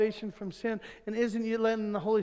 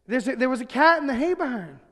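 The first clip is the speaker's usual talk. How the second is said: louder and higher, making sure she hears; insistent retold call; 4 semitones higher, 7 dB louder than usual. Brother repeating so his sister hears, insistent. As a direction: imitate a boy calling out to his sister. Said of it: "he really wants her to hear".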